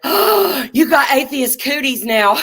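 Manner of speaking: high, loud hammed-up shock; teasing the landlady with a hammed-up gasp of horror; mock horrified gasp.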